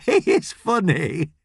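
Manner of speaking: put-on high, chuckling old nan remark; laugh, then say it slowly, tickled; amused laughing. A narrator's reading. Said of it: "Gladys laughs and says to Ellie".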